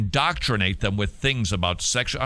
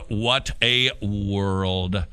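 very slow, lower, every word stretched; drawn-out lament; 4 semitones lower, much slower than usual. Real, not acted. Host despairing over children's friendships, drawn-out lament.

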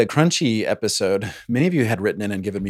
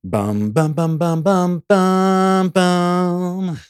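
rising hummed news-show sting, higher; investigative news theme hum; 8 semitones higher, 4 dB louder, much slower than usual. Pretend. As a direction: hum a dramatic TV news-magazine intro. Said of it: "sixty seconds slash investigative reporter noise".

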